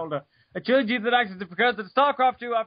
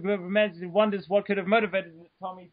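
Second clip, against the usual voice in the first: normal talking voice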